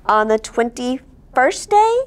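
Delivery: usual speaking voice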